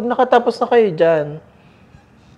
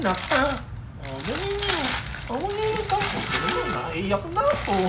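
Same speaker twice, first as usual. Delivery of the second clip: mahinang pag-aalo; 11 dB quieter than usual. Acted. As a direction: coo softly to calm a crying kid. Inang nag-aalo sa umiiyak. (malambing na pag-alo sa anak na nagtatampo)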